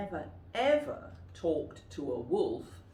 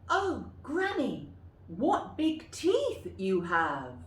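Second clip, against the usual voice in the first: high start, slow and loud; amazed girl, drawn out; 5 semitones higher, 3 dB louder, slower than usual. Shown as a demonstration. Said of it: "practice them yourself".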